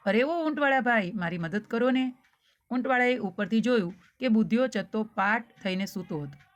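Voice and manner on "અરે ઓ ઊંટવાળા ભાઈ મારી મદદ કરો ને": plain reading voice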